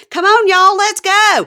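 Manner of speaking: loud, high Southern cheer; Southern drawl. Retold or imitated. Rallying friends in Mississippi, loud Southern accent. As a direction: belt it out like a Mississippi local. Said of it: "When I go to Mississippi ... I'm like".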